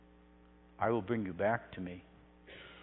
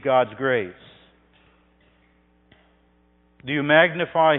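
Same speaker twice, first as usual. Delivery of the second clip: louder, urgent pulpit appeal; preacher challenging the congregation, voice raised; fervent preaching; 3 semitones higher, 9 dB louder than usual. Acted. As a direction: press each question home on the listeners.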